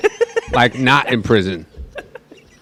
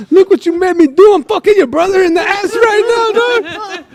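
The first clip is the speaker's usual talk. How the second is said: very high, louder mock wail; hammed-up tearful accusation, pitched way up; retold crying; 4 dB louder than usual. Storyteller imitates crying man phoning the victim's brother, mock wail. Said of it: "and he was crying".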